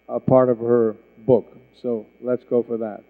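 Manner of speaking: ordinary delivery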